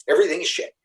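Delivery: imitating Cindy snapping in frustration; mock angry outburst. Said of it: "one time she got so mad".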